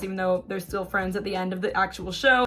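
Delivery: usual speaking voice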